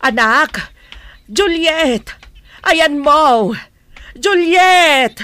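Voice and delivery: loud calls searching the house; calling out loud. Acted. Mother calling for her missing daughter, loud. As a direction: shout her name through the house.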